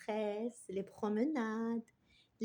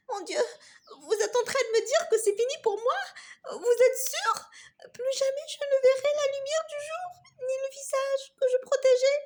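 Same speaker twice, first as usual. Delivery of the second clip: high, fast, sobbing voice; trembling, tearful panic; 12 semitones higher, 5 dB louder, faster than usual. Acted. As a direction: plead in near tears. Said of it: "La petite branche se mit à trembler". (voix tremblante, au bord des larmes)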